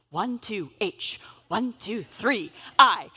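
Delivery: slow, counting out keypresses. Retold or imitated. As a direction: count each press slowly.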